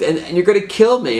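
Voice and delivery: high, worried voice; fearful, pitched well up; frightened hostage. Retold or imitated. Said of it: "he's like".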